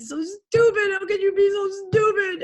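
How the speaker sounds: high, loud crying shout; retold tearful outburst at herself; crying self-reproach. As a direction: sob it out while scolding yourself. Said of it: "when I said".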